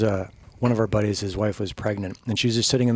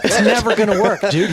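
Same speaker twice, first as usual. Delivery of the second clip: high, loud, laughing protest; 9 semitones higher, 7 dB louder than usual. Retold or imitated. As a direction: plead through laughter. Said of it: "I'm like".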